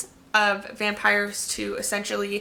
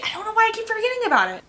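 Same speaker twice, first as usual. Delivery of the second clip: much higher and fast, exasperated at herself; exasperated squeal; 12 semitones higher, much faster than usual. Real, not acted. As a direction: blurt it out high and quick, fed up. Restarts the same sentence exasperated.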